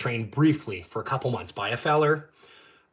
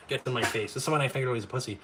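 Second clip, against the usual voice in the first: ordinary talk, nothing marked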